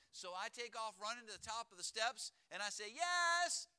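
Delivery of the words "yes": high, loud answering call; imitated kid yelling down the stairs; feigned innocence. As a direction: call back as if clueless. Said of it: "as if I don't know why she's calling".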